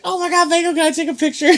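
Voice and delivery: high-pitched mock kid voice; excited kid. Kid asking Mango for a picture, high-pitched excited imitation. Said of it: "and the kid was like".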